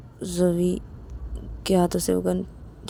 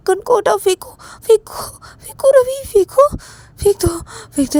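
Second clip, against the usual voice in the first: 1 panicked pleading; 8 semitones higher than usual. Acted. Begging him to throw gun.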